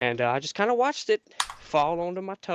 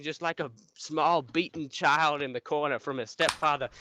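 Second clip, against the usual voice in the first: ordinary delivery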